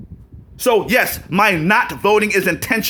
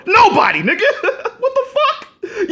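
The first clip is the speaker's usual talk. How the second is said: incredulous laughter. Real, not acted. Ridiculing what voters are told, incredulous and laughing. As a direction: crack up in disbelief, pitch soaring.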